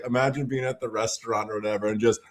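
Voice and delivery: normal talking voice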